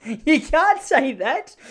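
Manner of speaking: high, very slow shocked protest; high stunned objection to a rude remark; shocked disbelief. Retold or imitated. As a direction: stretch out the outrage. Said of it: "And you go".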